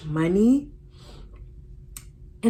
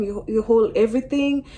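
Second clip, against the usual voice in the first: ordinary talk, nothing marked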